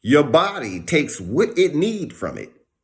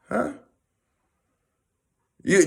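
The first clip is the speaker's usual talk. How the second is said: ordinary talk, nothing marked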